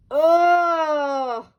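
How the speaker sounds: high, loud cry of dismay. Acted. Dora sees it's no egg, loud cry. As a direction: cry out in shock. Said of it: "cried Dora".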